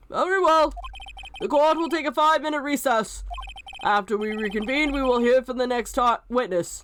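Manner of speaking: lightly voiced judge in court; courtroom judge. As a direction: pronounce the court's order like a judge.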